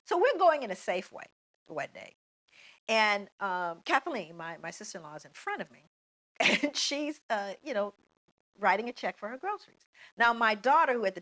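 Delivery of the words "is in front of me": usual speaking voice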